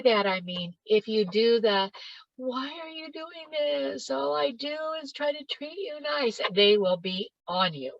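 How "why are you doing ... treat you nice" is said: higher, softer whiny plea; pleading weak mother. Retold or imitated. Mother pleading weakly with a critical teen, higher and softer.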